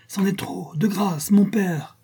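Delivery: high, desperate begging; pleading. A narrator's reading. Son interrupts his angry father, high pleading. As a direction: cut in, begging him to stop.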